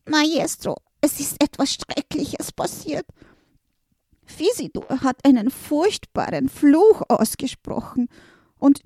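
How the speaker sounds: high, anxious creature voice; desperate little helper. Acted. Little helper tells the master of the curse, desperate. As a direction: pour out the disaster in a high panicky rush. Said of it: "sagte Valentin Viertel ganz verzweifelt".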